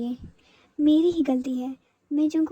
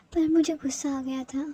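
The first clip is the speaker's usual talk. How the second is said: ordinary delivery, nothing marked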